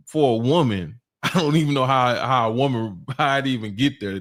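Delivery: ordinary delivery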